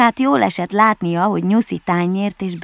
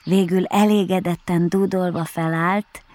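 ordinary delivery, nothing marked

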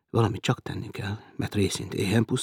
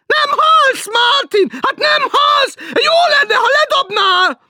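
much higher, loud and slow nagging; shrill teasing woman; 13 dB louder, slower than usual. Acted. Woman below teasing Martin, shrill and slow. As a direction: drag it out like a shrill landlady.

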